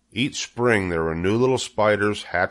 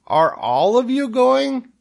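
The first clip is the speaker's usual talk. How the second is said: very high, loud, drawn-out question; Wilbur the pig, dismayed; 6 dB louder than usual. A narrator's reading. Wilbur asks departing spiders, dismayed and loud. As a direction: stretch it out in a high plea. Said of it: "asked Wilbur".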